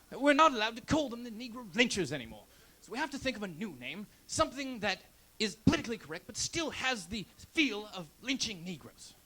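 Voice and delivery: lightly put-on British accent; a hint of a British manager. Comic voicing a British team manager in a mild British accent. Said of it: "He's British".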